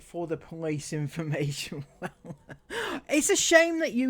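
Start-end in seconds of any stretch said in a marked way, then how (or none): 2.0-4.1 laughing. Real, not acted. Laughs mid-line.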